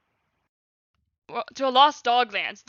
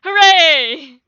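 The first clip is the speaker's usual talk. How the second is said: very loud, higher cheer; cheering exclaim; 5 semitones higher, 15 dB louder than usual. Acted. Violet cheering for her dad, loud hooray. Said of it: "exclaimed Violet".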